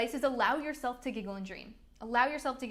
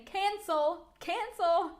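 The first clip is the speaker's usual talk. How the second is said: mock cancel; 7 semitones higher, 4 dB louder, much slower than usual. Voicing inner cancellation.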